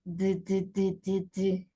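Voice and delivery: "d" repeated as short voiced taps. Shown as a demonstration. Teacher showing D is voiced, repeated isolated d. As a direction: tap out "d" five times with voice, evenly. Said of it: "La D sí".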